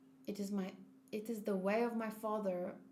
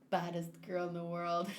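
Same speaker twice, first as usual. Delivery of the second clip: laughing through a softer quote; 5 semitones lower, 3 dB quieter than usual. Real, not acted. Mocking the bad translation, laughing softly. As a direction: giggle the words, amused by the bad translation.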